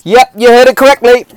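loud high exaggerated disbelief; mock outrage. Real, not acted. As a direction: sell the absurdity to the listeners.

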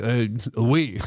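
low, hesitant mock-French reply; a hammed-up, low French accent; muddled French accent. Confirming the French accent to girlfriend, low and hesitant mock French. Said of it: "Do you have a French accent?".

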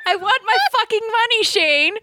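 high, fast put-on yelling voice; movie quote voice. Quoting the film's line to her co-host in a shrill put-on voice. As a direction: squeal it like the film character.

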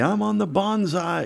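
a lightly voiced kid showing off; little brother bragging. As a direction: crow it like a proud little boy. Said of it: "he would make a big deal out of it and say".

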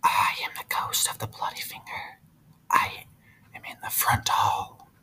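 eerie ghost whisper from downstairs; spooky whispered moan; ghostly whisper. Acted. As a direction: breathe it like a distant ghost. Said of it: "someone moaning these words".

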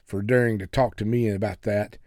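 plain reading voice

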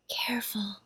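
hushed, whispered warning; very soft, urgent caution. A narrator's reading. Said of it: "whispered Janet".

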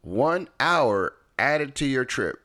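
high, slow mock navigation alert; GPS announcement. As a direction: draw out the delay like a GPS announcement. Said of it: "another one pops up".

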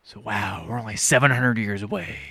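low, slow, grim drawl; Matthew McConaughey. Retold or imitated. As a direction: imitate a brooding actor killing the mood. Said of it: "Matthew McConaughey shows up. He's like".

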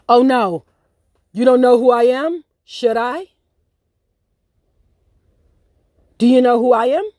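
mock indignant boss. Owner asserting himself, retold.

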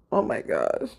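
low, breath-heavy dismay; exasperated sigh. Retold or imitated. Vlogger reliving the tight wig cap, exasperated. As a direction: sigh out a low, weary exclamation.

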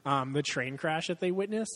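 ordinary delivery

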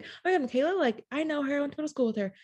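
high, excited gushing, retold; bubbly, friendly. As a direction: eagerly claim the acquaintance in a bubbly voice. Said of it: "just bubbly energy".

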